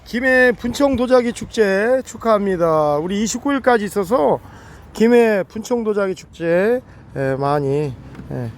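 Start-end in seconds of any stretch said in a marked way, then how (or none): 0.1-6.8 높고 크게 천천히 또박또박 알리기; 축제를 반갑게 외쳐 홍보하듯; 신나는 홍보. Real, not acted. 축제 홍보를 외침. (도자기 축제를 알리는 들뜬 홍보 목소리)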